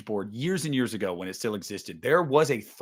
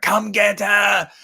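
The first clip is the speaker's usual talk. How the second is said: slow, drawn-out yell, much louder and higher; Loomis yelling; 8 semitones higher, 5 dB louder, slower than usual. Retold or imitated. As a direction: bellow it like you are luring the killer. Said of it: "And he's like".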